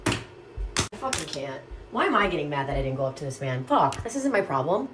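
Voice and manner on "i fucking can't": exasperated groan. Real, not acted. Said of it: "exasperated aside".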